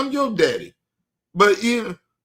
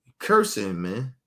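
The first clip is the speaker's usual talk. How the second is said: imitating a daughter; 7 semitones lower than usual. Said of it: "my daughter said".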